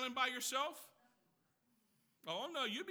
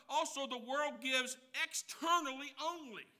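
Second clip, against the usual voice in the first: normal talking voice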